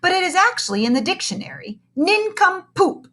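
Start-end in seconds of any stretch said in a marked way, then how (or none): 1.9-3.1 high, slow, drawn-out nincompoop; funny word. A narrator's reading. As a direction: savour the silly word up high. Reading a funny word aloud.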